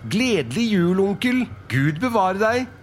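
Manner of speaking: high-pitched merry greeting; cheerful call. Acted. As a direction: call out with hearty Christmas cheer. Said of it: "en lystig stemme ropte". (lystig, høyt og lyst rop)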